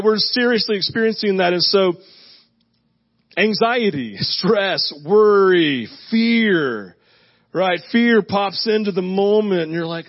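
usual speaking voice

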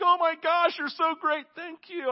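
high, breathless gushing gratitude, hammed up; mock gushing thanks. Grateful person thanking a helper, mock gushing. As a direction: gush like someone just rescued.

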